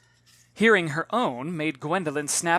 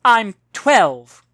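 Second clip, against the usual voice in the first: correcting; 5 semitones higher, 13 dB louder than usual. Acted. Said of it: "Gwendolen corrected".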